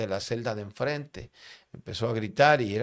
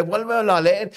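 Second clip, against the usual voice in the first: high, loud retold yell; pitched-up, forceful cry; shouted command; 9 semitones higher, 6 dB louder than usual. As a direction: urge him loudly to read, imitating a prisoner. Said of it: "me gritó aquel hombre".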